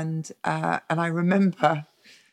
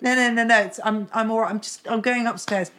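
high, rushed excuse-making; flustered protest; 6 semitones higher than usual. Retold or imitated. Telling staff she is just going upstairs, flustered protest. Caught on stairs, pleading with staff.

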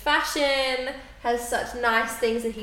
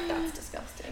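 disgusted whisper; 10 dB quieter than usual. Retold or imitated. Parents react to soccer.

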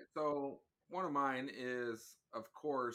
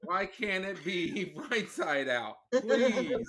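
half-laughing plea, rising to a much louder, higher please; pleading whine; 5 semitones higher, 7 dB louder, slower than usual. Real, not acted. Husband begging his wife to fix her laundry, exaggerated whiny plea. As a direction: beg with mock desperation.

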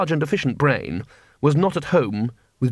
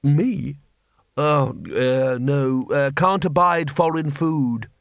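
ordinary delivery

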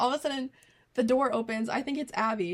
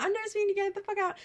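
high-pitched mock tirade; imitating angry friend; 10 semitones higher, much faster than usual. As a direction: repeat the banishment in a high, mad voice. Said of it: "you're all mad".

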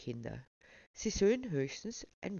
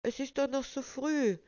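put-on whiny little boy; 9 semitones higher than usual. A narrator's reading. Waldo protests bedtime as child.

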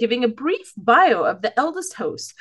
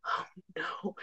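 whispered; 4 semitones lower, 18 dB quieter than usual. Retold or imitated. Quiet dismay after realizing.

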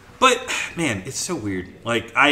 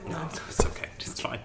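soft, unvoiced whisper; 3 semitones higher, 9 dB quieter than usual. Real, not acted. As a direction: whisper it, half joking. Quiet self-deprecating reply.

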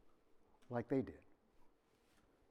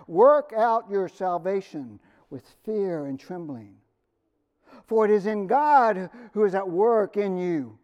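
scripture reading; 3 semitones higher, 8 dB louder than usual. Real, not acted. Pastor reading Paul's letter to the church, loudly and solemnly. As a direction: proclaim the letter with weight. Reads Philippians aloud.

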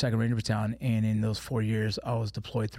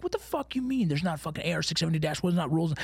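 imitating an exasperated rant, pitched much higher; retold rant; 7 semitones higher than usual. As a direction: rattle off the regs, high and incredulous. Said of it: "I'm like".

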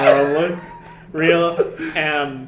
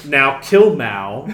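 slow mock order; 6 dB louder, slower than usual. Stalin's order retold.

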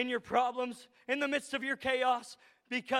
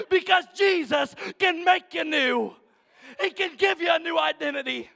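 loud, raised, rhythmic sermon climax; impassioned preaching; 3 semitones higher, 7 dB louder than usual. Real, not acted. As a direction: hammer each phrase home to the congregation. Preacher builds to full force.

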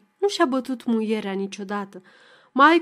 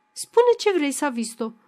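exasperated demand; 4 semitones higher than usual. Acted. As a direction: push her hard to speak, losing patience. Wife pressing Savista, rising anger.

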